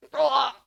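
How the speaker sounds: short loud pained grunt; pained grunt after a knee to the neck. Acted. Snape kneed in the neck, short loud grunt. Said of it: "Snape says".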